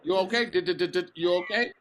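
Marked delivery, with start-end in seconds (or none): 0.5-1.1 rapid repeated d sounds, imitating a stammer; mock stutter. Asking the stammering friend if he is okay, mock stutter. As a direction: tease with a stuck-on-D stutter. Teasing the other speaker's stutter.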